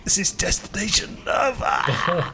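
a dying man's last words plug the audio; deathbed confession. Pretend. Mock deathbed speech to the hosts, faint then slow and high. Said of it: "A deathbed confession".

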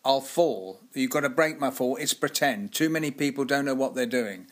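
put-on testy old director-actor lecturing a child actor, loud; tetchy Hartnell. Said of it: "He'd get a bit tetchy with you and say".

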